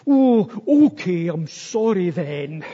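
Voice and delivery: loud, exasperated mock child's sorry; grudging apology. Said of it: "then there's this kind of grudging".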